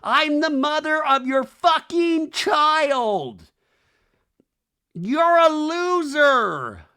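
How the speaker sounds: high, loud, drawn-out mock screaming; hammed-up screeching, words stretched long; imitated scream. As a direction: scream it slowly like a furious ex. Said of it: "And then she screams".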